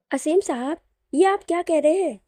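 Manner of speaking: high, loud startled question; alarmed surprise. A narrator's reading. Natasha, stunned by Aseem's outburst, alarmed surprise. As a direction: blurt it out in alarm. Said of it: "घबरा गई और जल्दी-जल्दी हैरत से बोली".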